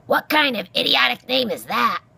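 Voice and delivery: loud, high-pitched derision; scornful porcupine. Acted. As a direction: sneer loudly as a cranky animal. Porcupine mocks the mouse's name.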